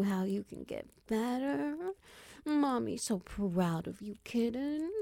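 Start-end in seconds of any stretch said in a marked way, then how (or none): none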